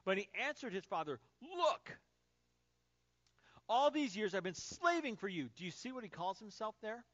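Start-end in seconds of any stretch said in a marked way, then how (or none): 1.4-5.5 angry, loud protest with a hard punch on key words; bitter resentment. Acted. Elder son protesting to his father, angry and bitter. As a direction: throw the grievance at him, louder. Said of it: "angry, bitter resentment".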